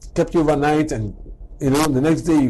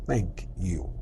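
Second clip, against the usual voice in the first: low, soft and deliberate; a quiet, careful thank you; proper thank you; 8 semitones lower, 8 dB quieter than usual. Shown as a demonstration. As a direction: say it as the correct full phrase. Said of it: "the real phrase is".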